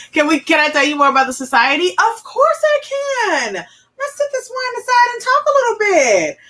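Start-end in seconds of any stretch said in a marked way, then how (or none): 1.9-6.4 high, slow, sing-song reply to herself; playful self-answer. Real, not acted. Host answers her own question to viewers in a high sing-song. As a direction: tease yourself sweetly.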